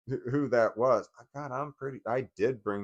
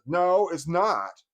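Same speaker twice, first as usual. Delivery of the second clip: loud, drawn-out mock contradiction; rude; 7 semitones higher, 7 dB louder, much slower than usual. Mother rudely cuts in about the phone picture, mock rude. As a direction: imitate a rude, high-pitched interruption. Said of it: "instantly out of nowhere was just rude".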